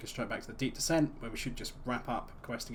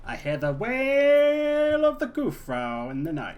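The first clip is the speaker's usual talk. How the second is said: much higher, louder, slow ghostly wail; eerie wailing voice; 12 semitones higher, 11 dB louder, slower than usual. Pretend. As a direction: moan it like a haunting spirit. Spooky dramatic reading.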